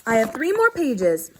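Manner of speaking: ordinary talk, nothing marked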